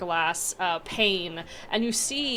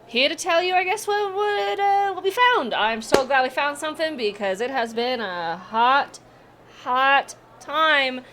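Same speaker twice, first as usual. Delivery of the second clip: high, louder gnome voice; weary gnome; 6 semitones higher, 5 dB louder than usual. Acted. Gnome telling the party about their hot work, high. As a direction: complain with tired relief.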